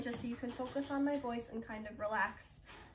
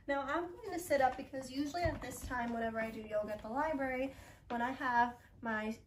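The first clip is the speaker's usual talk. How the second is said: usual speaking voice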